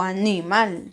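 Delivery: "animal" wrongly stressed as a-NÍ-mal; stress on middle syllable. Shown as a demonstration. Teacher shows another wrong way, "animal" stressed on NI. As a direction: lean on the NI in "animal". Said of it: "porque usted no dice".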